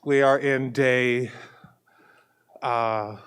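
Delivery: normal talking voice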